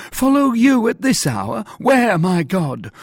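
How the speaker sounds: high, loud, alarmed questioning; crying out. A narrator's reading. Prisoner questioning the officer at night, crying out alarmed. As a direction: cry out in frightened disbelief. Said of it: "cried Bonacieux".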